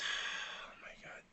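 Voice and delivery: whispered, slow oh my God. Real, not acted. After camera trouble, whispering to himself, slow and unvoiced. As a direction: breathe it out under your breath. Quiet exasperated aside.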